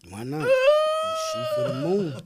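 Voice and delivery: high, drawn-out cheer; excited cheer. Real, not acted. Host cheering the rapper's dream collabs, soaring excited cry.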